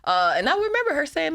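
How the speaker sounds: ordinary talk, nothing marked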